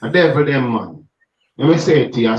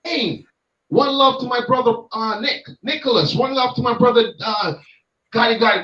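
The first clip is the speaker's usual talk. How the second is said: a lightly raised, hyped shout-out to the brethren; 6 semitones higher than usual. Retold or imitated. Imitating the gospel star calling out his brother's name, raised and hyped. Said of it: "if [name] was saying".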